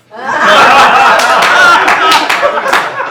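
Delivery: helpless loud laughter; loud high burst of laughter. Real, not acted. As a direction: break up laughing hard. Bursts out laughing after punchline.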